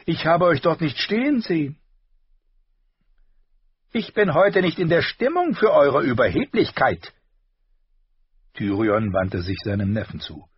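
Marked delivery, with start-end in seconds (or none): 0.0-1.8 higher, louder false apology; mock apology. A narrator's reading. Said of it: "sagte er". 3.9-7.1 higher, louder curt rebuke; Tyrion rebuking. A narrator's reading.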